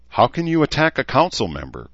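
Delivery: understated indignant question; angry. Acted. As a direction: push back at him with a little heat. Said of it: "Courtney said angrily".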